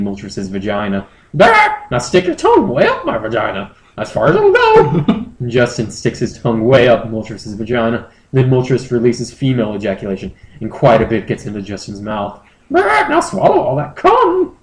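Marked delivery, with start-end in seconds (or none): 1.4-5.3 very high falsetto creature; squeaky bird-Pokemon voice; high Moltres voice. Acted. Moltres asking Justin to fly home, squeaky high. 12.7-14.6 very high falsetto creature; squeaky bird-Pokemon voice; high Moltres voice. Acted. Moltres asking Justin to fly home, squeaky high.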